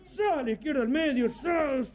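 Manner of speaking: high sharp "¡Zas!" like a whip; vocal whip crack effect; mock whip crack.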